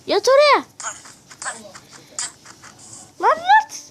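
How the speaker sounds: loud, high exasperated shout at a stubborn villager; frustrated yelling. Real, not acted. Shouting at a villager who won't get into bed, exasperated yell. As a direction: yell it at him to finally lie down.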